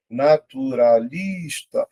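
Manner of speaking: "Naturalista" drawn out, LIS held; syllable by syllable. Shown as a demonstration. Teacher testing another option for stress, syllable by syllable. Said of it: "Vou separar".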